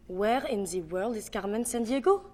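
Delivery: French accent, rising at the end. Shown as a demonstration. Breaking down the Carmen Sandiego line, French accent. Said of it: "how to do a French accent".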